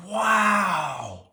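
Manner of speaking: amazed wow. A narrator's reading. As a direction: Brother seeing the real field. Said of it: "said Brother".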